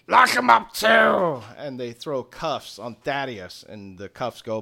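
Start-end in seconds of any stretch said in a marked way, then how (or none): 0.1-1.4 loud, drawn-out goblin command; raised, slow villainous order; shouted order. Acted. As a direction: bellow it slowly to the troops. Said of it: "And he goes".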